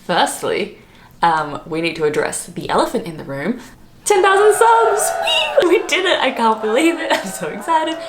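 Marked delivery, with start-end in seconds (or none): 4.1-8.0 high, squealing celebration; excited squeal. Real, not acted. Vlogger squealing to viewers over ten thousand subs, overjoyed. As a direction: squeal it out like you just won.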